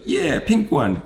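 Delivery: put-on very high eager child's voice; little girl voice. Girl answering the doctor about her cast, very high child voice. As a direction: chirp it like a kid who wants pink. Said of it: "and then the child".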